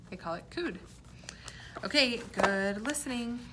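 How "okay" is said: ordinary delivery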